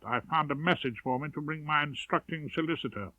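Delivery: usual speaking voice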